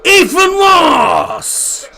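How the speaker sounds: drawn-out PA goal announcement; stadium announcer. Acted. Streamer mimicking the ground announcer, slow and high. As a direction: stretch the scorer's name like the tannoy.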